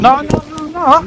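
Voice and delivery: panicked loud protest. Real, not acted. Driver dragged from car.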